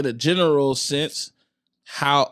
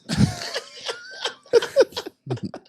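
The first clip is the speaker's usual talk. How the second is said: burst of laughter. Real, not acted. Big laugh at the joke.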